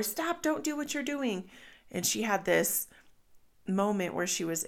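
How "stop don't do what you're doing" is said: retold shout. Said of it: "his way of just shouting".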